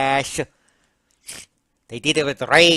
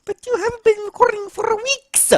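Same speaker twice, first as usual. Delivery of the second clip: high-pitched nagging character voice; accusing Mario; 4 dB louder than usual. Acted. Mario scolding Luigi about recording, accusing. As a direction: pester him in a high, reproachful voice.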